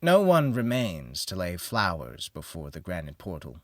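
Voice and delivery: plain reading voice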